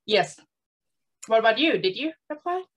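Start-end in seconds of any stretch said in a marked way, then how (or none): none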